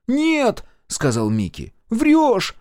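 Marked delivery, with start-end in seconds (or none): 0.1-0.6 very high sharp cry; child's denial. A narrator's reading. Микки rejects mother's news, crying out. 1.9-2.6 put-on high, loud child's shout; accusing child. A narrator's reading. Микки accuses mother of lying, shouting.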